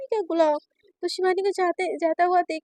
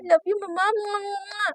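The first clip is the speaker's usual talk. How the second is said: soft smacking kisses; butter her up sweetly; blowing kisses; 4 semitones higher, 3 dB quieter than usual. Acted. Naina sweet-talking her mother with kisses, soft.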